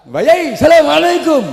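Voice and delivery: high, loud shouted salam. Acted. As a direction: holler the greeting at an old kiai.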